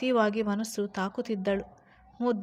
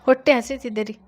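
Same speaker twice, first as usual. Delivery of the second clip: sulky plea; 9 dB louder than usual. A narrator's reading. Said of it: "ಮುಖ ಸಪ್ಪೆ ಮೋರೆ ಹಾಕಿ".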